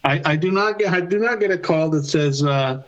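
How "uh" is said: usual speaking voice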